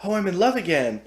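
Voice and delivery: gasp, then high-pitched lovestruck gush; imitated lovestruck swoon. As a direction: swoon like someone suddenly smitten. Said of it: "she goes from a total badass to".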